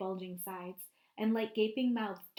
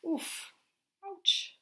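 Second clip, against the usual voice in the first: pained grunts; 7 semitones higher than usual. Pretend. Voicing tumbling Wumps.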